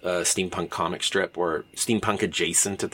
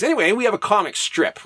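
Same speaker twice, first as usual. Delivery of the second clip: normal talking voice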